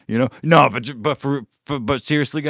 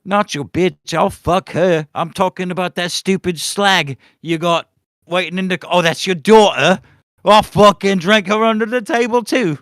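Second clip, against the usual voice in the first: high British pub braggart, building louder; hammed-up British boozer, rising to a loud finish; mock British accent; 6 semitones higher, 5 dB louder than usual. Drunken pub challenge to a rival, high mock British accent. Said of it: "that kind of British accent".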